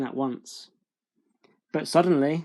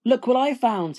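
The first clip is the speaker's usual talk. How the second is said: put-on high, eager young Jack; excited boy; 9 semitones higher than usual. A narrator's reading. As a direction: show off a treasure proudly. Jack proudly shows mother hen.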